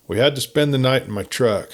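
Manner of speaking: ordinary delivery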